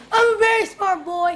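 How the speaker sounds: high, loud declaration with a laugh. Real, not acted. Child answering the teacher with a loud proud shout. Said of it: "say in a very loud voice".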